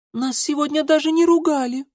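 put-on very high, piping child's voice; little girl. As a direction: pipe up like a small daughter. Little sisters confer among themselves.

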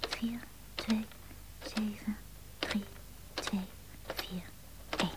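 whispering the digits while dialing; barely voiced numbers under her breath; murmuring the number to herself again; whispered digits.

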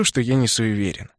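plain reading voice

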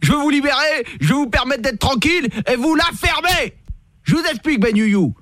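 high, hammed-up rant; ranting cop. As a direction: rant at him like an overbearing cop. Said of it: "pourquoi vous me parlez comme ça?".

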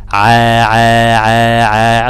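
ع held long with a thin, light vowel; thin ع drill. Shown as a demonstration. Teacher drills the student to separate sounds, thin ع. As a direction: repeat ع evenly, drawn out and light. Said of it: "لازم تتعلم تفرق. قول".

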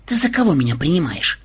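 a touch of wounded pride; mildly offended. A narrator's reading. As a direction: take slight offence. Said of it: "обиделся Ксенобайт".

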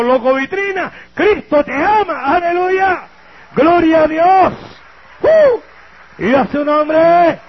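loud, high, drawn-out preaching cry; preacher's shout. Retold or imitated. Preacher shouting at the madman in the doorway, ecstatic and loud. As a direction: belt it like a fired-up street preacher.

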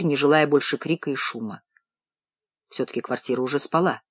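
plain reading voice

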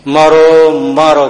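loud, drawn-out attack cry; ટોળાનો હુમલાનો પોકાર. Retold or imitated. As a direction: imitate a mob yelling as it charges. હુમલો કરતું ટોળું બૂમ પાડે. (હુમલાખોર ટોળાની લાંબી બૂમની નકલ)

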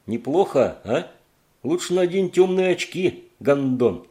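high, loud mocking jeer; defiant taunt. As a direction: sneer at the monster. Cody taunts the monster.

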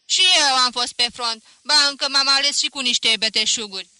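loud angry yell; shouting veteran. A narrator's reading. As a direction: bellow it back at him. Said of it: "Urlă".

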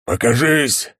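loud, high barked command; deep roar. A narrator's reading. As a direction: roar it out from the helmet. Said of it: "басовитый рык".

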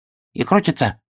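high little hedgehog voice; wondering hedgehog. A narrator's reading. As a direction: ask in a small, high voice of wonder. Hedgehog questions bear in story.

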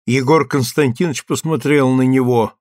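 ordinary delivery

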